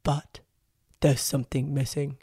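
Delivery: a hint of Felix sweet-talking before the letdown; imitating Felix. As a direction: play him gushing to Abigail, voice only slightly changed. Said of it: "I was gonna do her accent".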